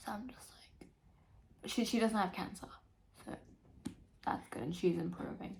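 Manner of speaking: soft unvoiced whisper. Real, not acted. Reader confiding about her mother, soft whisper. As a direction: quietly confide it.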